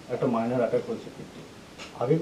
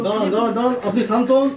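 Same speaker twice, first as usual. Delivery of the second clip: raised, high urgent call to calm down; loud command; 6 semitones higher, 5 dB louder than usual. Acted. Doctor loudly ordering the frantic son to calm down. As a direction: stop him with a loud order.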